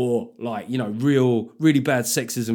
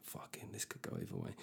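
hushed muttered panic; under the breath, hushed; whispered aside; 22 dB quieter than usual. Retold or imitated.

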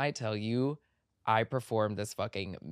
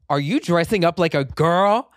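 louder, raised accusing question; mock the mother's outraged demand; angry mother; 6 semitones higher, 10 dB louder than usual. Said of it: "angry Egyptian woman screaming at me".

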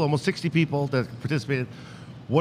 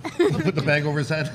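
normal talking voice

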